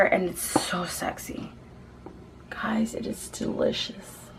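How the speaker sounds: sultry hushed whisper. Real, not acted. Praising the last shade to viewers, hushed whisper. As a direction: lean in and whisper it.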